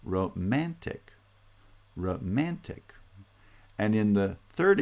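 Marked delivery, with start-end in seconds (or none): 0.0-2.9 Romantic slowly, ro-MAN-tic with MAN rising; stress on second syllable. Shown as a demonstration. Teacher showing the stressed second syllable, slow ro-MAN-tic for Romantic. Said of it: "the second syllable is stressed".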